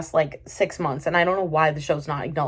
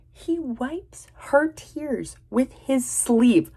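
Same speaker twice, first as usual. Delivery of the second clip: very slow, higher-pitched emphasis; appalled disbelief; 5 semitones higher, much slower than usual. Real, not acted. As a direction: drag out every word in outrage. Appalled retelling of a scene.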